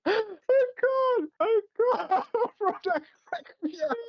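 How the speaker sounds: high-pitched, breaking up with laughter; barely getting words out through laughter; laughing to tears. Real, not acted. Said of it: "I'm tearing up".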